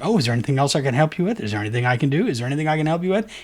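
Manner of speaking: rattled off fast, mock-helpful chatbot; annoying bot repeat. Mocking the bot that keeps offering help, fast and mock-polite. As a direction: hurry through it like a pushy bot. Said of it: "it gets very annoying".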